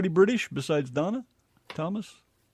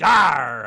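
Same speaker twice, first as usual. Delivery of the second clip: loud exasperated "arr"; short burst of loud exasperation; frustrated growl; 12 dB louder than usual. Real, not acted. Frustrated while hunting for a file.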